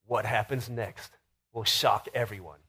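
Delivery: low, slow TV-narrator suspense; milk the suspense like a nature-show host; hushed, drawn-out documentary teaser; dramatic narrator. Retold or imitated. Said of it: "he's, like, so dramatic".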